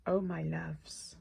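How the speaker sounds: slow, hushed marvelling; low-key adoring whisper; awed whisper. Real, not acted. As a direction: breathe it out softly and slowly. Hushed awe at the glitter.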